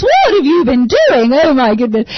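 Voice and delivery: put-on high, loud exasperated parent scolding kids; the parent finding a mess, fed up. Parent walking in on the kids' mess, high exasperated scold. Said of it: "when you walk in and the kids have made a mess".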